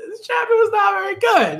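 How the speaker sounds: high laughing exclaim; laughing shout. Real, not acted. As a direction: blurt it out mid-laugh. Repeats verdict in laughing shout.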